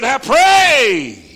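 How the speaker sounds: high, loud pleading cry of the rich man; preacher wailing the rich man's plea; preaching at full force. Acted. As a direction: cry it up as a man begging from hell. Voicing the rich man in hell.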